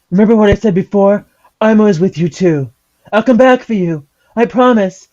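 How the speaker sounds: hammed-up heartfelt vow; Kingdom Hearts hero. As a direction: play the dramatic game hero. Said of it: "Kingdom Hearts moment".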